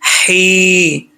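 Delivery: ही held long on breathy throat h. Shown as a demonstration. Teacher spells the syllable, careful articulation. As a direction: push ही from the throat, hold the ee. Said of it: "हल्क से है".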